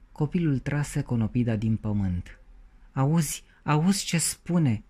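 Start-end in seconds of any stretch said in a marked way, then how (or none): 2.9-4.8 alarmed. A narrator's reading. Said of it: "zise alarmată doamna Conopidă".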